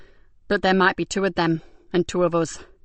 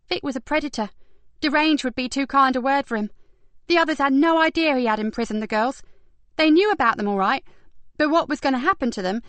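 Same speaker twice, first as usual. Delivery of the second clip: put-on lightly higher young woman voice; brisk higher detective voice; Kat voice; 7 semitones higher than usual. A narrator's reading.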